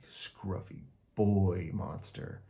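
plain reading voice

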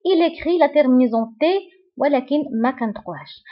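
ordinary talk, nothing marked

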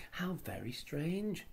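slow, high, wondering; amazed. Acted. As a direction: draw it out in awe. Children amazed at magic tree.

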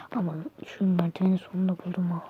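hushed whisper to viewers; whispering stream talk. Real, not acted. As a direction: keep it low, late night whisper. (izleyicilere fısıltıyla)

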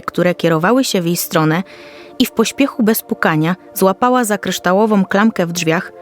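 ordinary delivery

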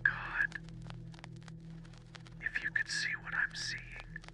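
trembling, breathless whisper; shaky whisper. Acted. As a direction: whisper it, barely holding together. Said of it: "called below in a shaky whisper".

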